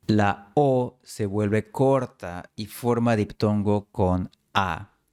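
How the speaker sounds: ordinary talk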